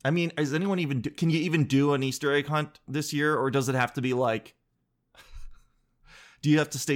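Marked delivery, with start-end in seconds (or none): none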